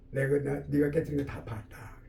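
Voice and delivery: low, soft, slowing to the end; gentle father. Acted. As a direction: reveal it quietly, without reproach. Father's quiet revelation to child. (나직하고 느린 아버지 목소리)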